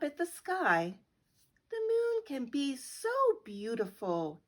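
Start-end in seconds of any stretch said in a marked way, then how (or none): none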